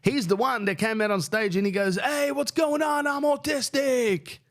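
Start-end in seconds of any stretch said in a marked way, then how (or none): 2.0-4.4 much higher, slow mock yell of the MC; high, drawn-out imitation of an MC yelling to the crowd. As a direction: ham up the MC shouting it to the crowd. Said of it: "screaming that".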